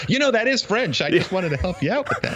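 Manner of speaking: high, smug know-it-all voice; mock helpful commenter. Guest mocking online commenters in a high, smug voice. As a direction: mimic a condescending helper. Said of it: "helpful commenters said".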